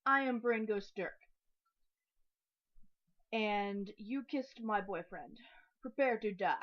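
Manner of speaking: loud, dramatic swashbuckler challenge; Princess Bride duel line. Retold or imitated. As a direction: declare it like a sworn avenger. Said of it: "mimicking Princess Bride when he says".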